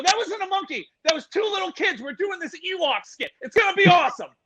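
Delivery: high-pitched eager insistence; mock-enthusiastic high voice; hasty cover-up. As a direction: sell the fib in a high excited voice. Covering up the escape to guards.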